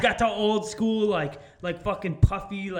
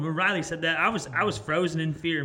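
ordinary delivery

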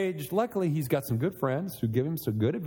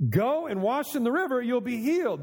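pitched up, lightly mocking paraphrase; mocking Elisha's offhand message; 7 semitones higher than usual. Pastor mimics the prophet's casual command, pitched higher. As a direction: toss off the instruction as if it's nothing. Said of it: "You know, just".

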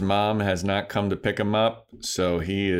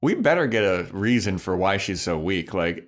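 ordinary talk, nothing marked

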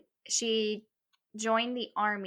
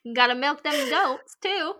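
farmer voice; 6 semitones higher, 7 dB louder than usual. Pretend. Joining the farm bit.

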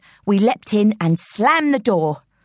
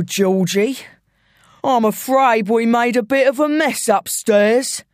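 taunting London gangster, mock-genial; London villain. Intruder needling George about the mess upstairs, taunting. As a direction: toy with the victim in broad London voice.

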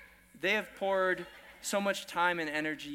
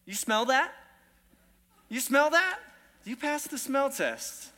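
sniffing question; 8 semitones higher, 4 dB louder than usual. Shown as a demonstration. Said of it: "Turn to someone and say".

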